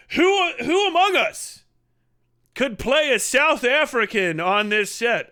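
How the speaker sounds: high, slow, proclaiming question; grand casting call. Acted. Director appealing to the crew, grandly declaimed. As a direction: ask the room like a theatrical orator.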